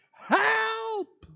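high shouted call up the well; calling for help. A narrator's reading. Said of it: "riep hij".

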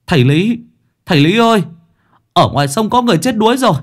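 frantic high villager calling for help; panicked shout. Acted. Villager calling the chief in panic, frantic high yell. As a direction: yell for the chief in terror, fast. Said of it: "tiếng gọi thất thanh".

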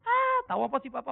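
mock a kid talking back to dad; bantahan anak. Anak membantah nasihat papanya. (menirukan anak membantah)

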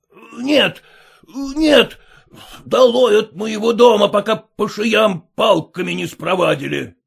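loud furious refusal and threat; shouting refusal. A narrator's reading. Master refuses Sergei and orders him out, shouting. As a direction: drive him off the property.

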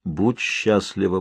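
slow, low, soft monotone; droning figure. A narrator's reading. Figure passing a message to the wife, droning. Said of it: "пробубнила фигура".

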